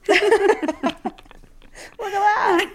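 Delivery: high, loud words through laughter; laughing. Real, not acted. Host cracks up at her co-host's wow, laughing. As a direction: squeal it out while cracking up. Both hosts laughing at the pun.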